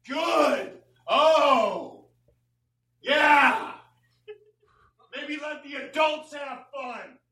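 hammed-up furious yelling at a dog; mock rage. Comic yelling at a dog behind the door, mock furious. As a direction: rant at the dog like a wronged friend.

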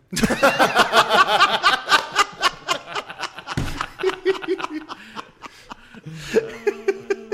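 a long, very high, loud cackling laugh; long high cackle. Real, not acted. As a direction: cackle hard at your own punchline. Cackles at own punchline.

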